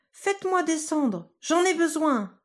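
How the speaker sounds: put-on a tiny boy asking to be let down; tiny Tom voice.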